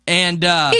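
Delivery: ordinary talk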